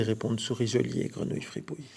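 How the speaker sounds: ordinary delivery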